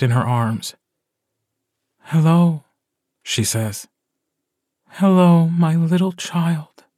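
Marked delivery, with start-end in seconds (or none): 2.0-2.6 high, cooing hello; mother's lilting first hello; tender greeting. A narrator's reading. Mother greets her newborn. 4.9-6.8 put-on high, slow cooing to a baby; mother's lilting welcome to her newborn; tender greeting. A narrator's reading.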